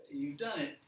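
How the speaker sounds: ordinary talk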